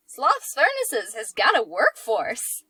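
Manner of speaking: put-on old Hollywood announcer, slow and loud; grand nineteen-fifties newsreel voice; transatlantic accent. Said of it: "I like how you almost went nineteen fifties with that".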